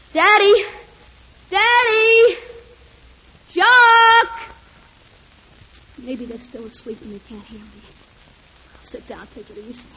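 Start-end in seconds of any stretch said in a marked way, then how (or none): 0.1-4.5 loud desperate call, higher; calling for help. Acted. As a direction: shout up for help. Boy calling from the crevice.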